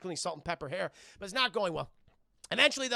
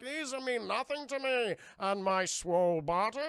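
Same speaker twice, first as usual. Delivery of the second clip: lightly put-on brute boast; Bane voice; 3 semitones higher than usual. Bane shrugs off the chains, mock boast. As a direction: brag like a musclehead. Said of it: "He's like".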